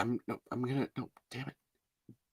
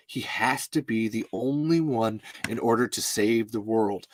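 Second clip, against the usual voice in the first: usual speaking voice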